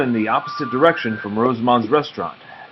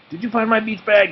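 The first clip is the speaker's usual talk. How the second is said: put-on high, eager little boy voice; 12 semitones higher, 4 dB louder than usual. A narrator's reading. Oliver asking Nate about his bag, high eager voice. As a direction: voice Oliver pestering.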